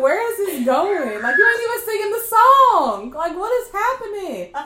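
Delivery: loud, high-pitched incredulous complaint; mock exasperated bewilderment. Grandchild objecting to grandma's singing, loudly exasperated. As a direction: protest in comic disbelief, half laughing.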